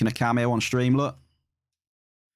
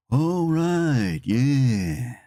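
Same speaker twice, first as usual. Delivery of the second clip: a higher, mock game-character "All right, yeah"; silly emote voice; 3 semitones higher, much slower than usual. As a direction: copy the stupid-sounding emote. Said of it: "The one that goes".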